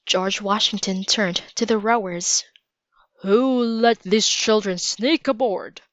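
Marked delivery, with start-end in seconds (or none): 3.2-5.8 stern commander's demand. A narrator's reading. George Washington questions his rowers about the children, in a stern voice. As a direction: question them sternly, demanding who's responsible. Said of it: "in a stern voice".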